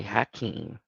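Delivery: usual speaking voice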